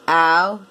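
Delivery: OUTLAW as ow, t, l, aw; "Out-law" pulled apart into four separate sounds. Shown as a demonstration. Teacher counting four sounds, OUTLAW sound by sound. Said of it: "Four sounds in that word".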